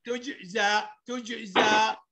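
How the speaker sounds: تجزى with an echoing ج; qalqalah bounce. Shown as a demonstration. Teacher demonstrating to pupils, تجزى with bounced ج. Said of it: "بقلقلها بقول إيه".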